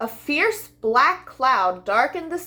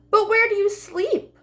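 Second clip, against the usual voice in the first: high lamb voice; 10 semitones higher than usual. Acted. Lamb asks, much higher.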